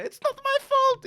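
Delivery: high, loud, slow mock French waiter accent; a hammed-up French waiter accent. Guest imitating a rude French waiter, hammed-up French accent. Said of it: "I can't do the accent".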